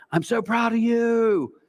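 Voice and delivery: imitating a gushing dad, high and slow; gushing proud dad. Mock dad cheering his sons off the field, high and slow. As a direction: stretch it out like a beaming father. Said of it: "and he does this".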